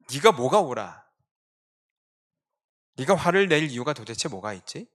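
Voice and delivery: loud accusing challenge; angry shout. Retold or imitated. Preacher imagining shouting at Jonah, loud accusation. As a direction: demand he justify his anger. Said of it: "진짜 화가 나서 소리치고 싶었습니다".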